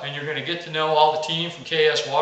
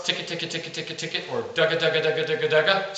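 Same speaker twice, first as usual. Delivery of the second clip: even, rattling run of repeated syllables; feed call chuckle; 3 dB quieter, faster than usual. Shown as a demonstration. Coach voicing the roll and chuckle, rapid patter. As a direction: patter it out softly like feeding ducks. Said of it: "it's a simple note".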